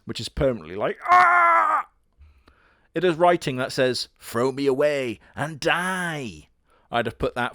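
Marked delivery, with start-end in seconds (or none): 1.1-1.9 sudden high shrieked Ah; a cartoonish frozen-face yelp; 1 mock scream. 4.2-6.5 1 creepy doll voice. Pretend. Reading the doll's creepy message.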